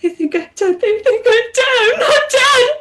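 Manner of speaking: high, rising, breaking sob; crying goddess. Acted. Abandoned goddess crying to the diver. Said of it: "She is crying".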